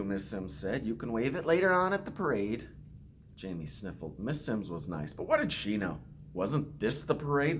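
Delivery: put-on gentle storytelling read to children; story narration. Principal reading a picture book to students, gentle storytelling.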